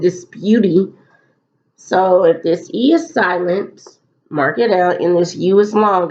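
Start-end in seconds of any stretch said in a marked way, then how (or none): 0.0-0.9 disputy with the final E sounded as "ee"; deliberate mispronunciation. Shown as a demonstration. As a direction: say disputy wrongly, ending in a voiced "tee". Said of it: "I know the word isn't".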